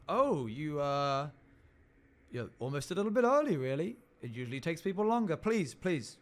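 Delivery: high, loud and quick, eagerly fussy; eager hall keeper. Acted. Tall man welcomes the early letter carrier, high and quick. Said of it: "And he goes".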